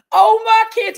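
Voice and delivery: loud, high mocking whine of a victim. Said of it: "he's over playing victim".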